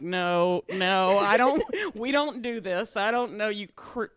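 indignant dog. Retold or imitated. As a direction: refuse in a huffy, drawn-out comic dog voice. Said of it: "she just stood at the end".

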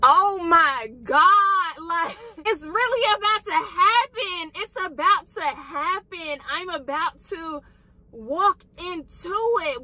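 choked-up excitement, words stretched out; emotional, on the verge of tears. Real, not acted. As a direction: voice lifting high with emotion. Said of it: "I started to tear up".

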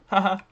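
loud, high "haha"; laugh. Real, not acted. Player cracking up after taunting an opponent, laughing.

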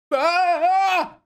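sudden high loud scream; startled scream. Acted. Waking robot imagines the frying pan attacker, startled scream. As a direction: shriek as if the pan is still coming.

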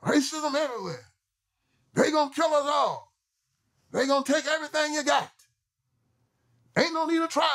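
high, mocking preacher of despair; mocking their message. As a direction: ham up the hopeless sales pitch, pitched high. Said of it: "Yeah, that's them".

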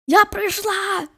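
high, slow, sing-song "Я прийшла!"; excited call. Real, not acted. Announcing arrival in game.

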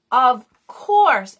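loud, drawn-out call of realisation. A narrator's reading. Emma struck by an idea, calling out loudly. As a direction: call it out with sudden force. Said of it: "Emma called".

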